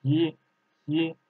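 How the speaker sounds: "Yi" said in second tone; teacher models "Yi" in Mandarin second tone. Shown as a demonstration. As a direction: demonstrate "Yi" slowly as a lone tone syllable. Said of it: "Second tone".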